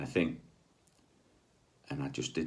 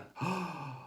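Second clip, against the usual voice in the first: gasp; 12 semitones higher, 8 dB quieter than usual. Retold or imitated. Shocked at "just a healer.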